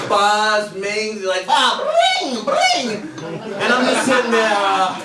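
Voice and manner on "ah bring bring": high mock telephone ring; mimicking a guy doing phone noises. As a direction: squeal it like a ringing phone. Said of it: "he's doing telephone noises".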